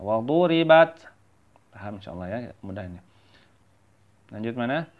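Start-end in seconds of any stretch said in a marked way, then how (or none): 0.0-1.1 "waduribat" higher and firm, long du; recitation demo. Shown as a demonstration. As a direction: chant "waduribat" with every syllable clear. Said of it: "seperti itu ya".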